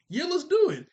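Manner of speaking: put-on high, loud burst of boyish excitement; eager agreement. Chucky eagerly agreeing to Tommy's bros pact, high and loud. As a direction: fire up like a kid sealing a pact.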